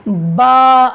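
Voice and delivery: बा loud and long, mouth wide open; open-mouthed drawn-out baa. Shown as a demonstration. Teacher models the letter for students to repeat, loud open बा. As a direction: hold the vowel of बा open, ringing out loud. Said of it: "मुंह खोल कर पढ़ना है".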